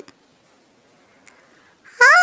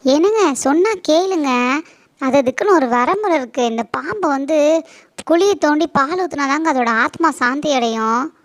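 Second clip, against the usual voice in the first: raised voice, pressing her husband to listen; loud pleading; 4 dB louder than usual. Acted. Wife insists loudly to husband on the snake ritual. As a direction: push hard, louder, she will not let it go.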